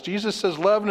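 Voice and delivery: ordinary delivery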